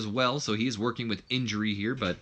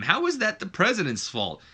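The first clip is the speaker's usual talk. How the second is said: ordinary talk, nothing marked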